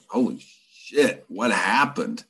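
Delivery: mild retold shock, stretching the first words. Teller reacts to the man's wired jaw, shocked. Shocked at seeing injured man.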